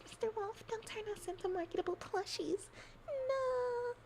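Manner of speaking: very high, crying little plea; high mock-crying plea to Mister Wolf; squeaky pleading victim. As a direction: beg the wolf in a tiny wailing voice. Pleading plushie voice.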